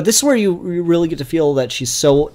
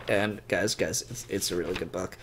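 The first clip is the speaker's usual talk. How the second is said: softly pleading; 6 semitones lower, 13 dB quieter than usual. Real, not acted. Pleading with viewers.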